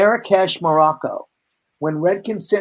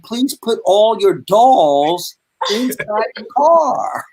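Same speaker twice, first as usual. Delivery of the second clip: slow, higher, emphatic punchline; mock stern scolding; 4 semitones higher, much slower than usual. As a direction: imitate an exasperated policeman, stretching each word. Officer's punchline scolding, drawn out.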